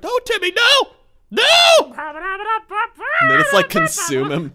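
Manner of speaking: very high, loud mock-desperate scream; frantic scream. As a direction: wail it like a horror movie mom.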